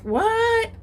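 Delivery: high startled yelp; jolted awake and confused; startled what. Retold or imitated. Woken woman startled by a yelling man.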